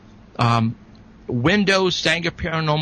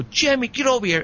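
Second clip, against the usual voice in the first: retold yell; 11 semitones higher than usual. Witness retelling how he called Jimmy over, mock yell. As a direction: call him over in a raised pitch, half-yelling. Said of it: "I yelled, I go".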